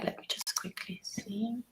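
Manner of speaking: hushed whisper while finding a slide; soft unvoiced aside, mostly off mic. Real, not acted. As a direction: breathe it under the voice, half to herself. Host setting up a slide.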